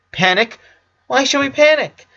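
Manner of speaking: rising high and loud into panic; panicking. Acted. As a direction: voice climbing sharply, losing control. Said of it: "asked Lenny, panicking".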